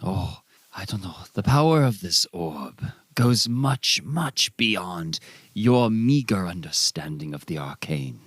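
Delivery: lofty, condescending mystic; a touch of mock grandeur; haughty mystic. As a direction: look down on her meagre understanding. Ara haughtily dismisses lieutenant.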